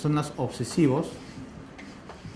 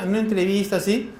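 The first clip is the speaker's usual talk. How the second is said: normal talking voice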